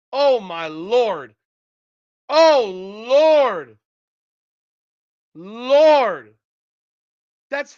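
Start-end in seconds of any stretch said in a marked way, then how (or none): 0.1-6.3 exasperated groan. Real, not acted.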